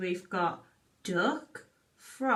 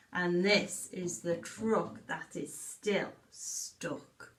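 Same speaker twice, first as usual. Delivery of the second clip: put-on reading a story to children; 3 dB louder, slower than usual. Reads picture book aloud.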